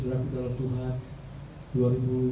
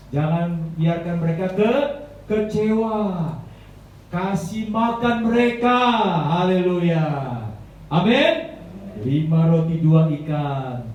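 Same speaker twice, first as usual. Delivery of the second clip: higher, louder, slow sermon voice; slow, higher and louder preaching; full-force preaching; 4 semitones higher, 6 dB louder, much slower than usual. Acted. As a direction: proclaim it to the whole church. Preacher builds to a climax.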